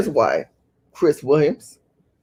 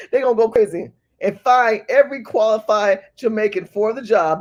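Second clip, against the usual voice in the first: usual speaking voice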